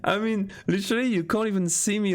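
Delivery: giggling. Real, not acted. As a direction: laugh with glee, then savour it. Laughing at the rich emote.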